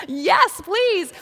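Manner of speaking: put-on high, loud eager child; eager yes please. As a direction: grab the offer like a thrilled kid. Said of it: "And he said".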